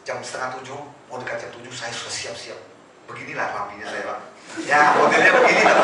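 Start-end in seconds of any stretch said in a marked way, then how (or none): none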